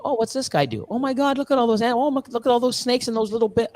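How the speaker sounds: high, fast wide-eyed gawking; high, rushed mock amazement. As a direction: rattle it off like an amazed newcomer.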